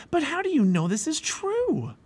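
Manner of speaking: put-on very high, wondering boy voice; amazed question. A narrator's reading. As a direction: ask in high-pitched wonder.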